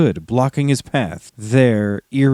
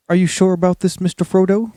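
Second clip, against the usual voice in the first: higher worried hobbit; Sam voice; 6 semitones higher, faster than usual. A narrator's reading. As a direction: voice Sam a little higher and anxious. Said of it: "Samwise Gamgee asked".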